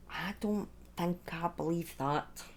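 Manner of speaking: hushed doubtful whisper; breathy conspiratorial aside. Real, not acted. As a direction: lean in and whisper your doubt.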